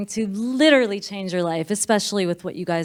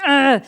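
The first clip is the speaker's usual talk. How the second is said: loud, raised "ugh"; mock frustrated groan; 5 semitones higher, 7 dB louder than usual. Driver stuck in traffic, mock groan of frustration. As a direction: imitate an exasperated groan.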